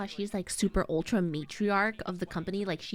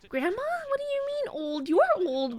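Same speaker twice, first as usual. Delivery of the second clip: high, indignant retort to grandma; acted incredulous protest; mock outrage; 12 semitones higher, 4 dB louder than usual. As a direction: squeal in offended disbelief, then louder.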